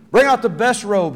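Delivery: loud, high call to servants; commanding father. Acted. Father calling servants to fetch the robe, loud and joyful. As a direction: ring it out like a glad father.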